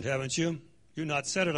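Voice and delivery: ordinary talk, nothing marked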